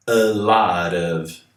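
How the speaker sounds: slow model. Shown as a demonstration. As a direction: say A lot of slowly with a tapped T. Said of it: "um D aqui".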